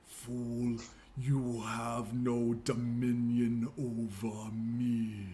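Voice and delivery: slow, drawn-out demonic voice; otherworldly voice. Acted. Game master as possessed creature answering a spell, otherworldly. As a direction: intone it slowly, like a possessing spirit. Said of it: "otherworldly voice that will simply say".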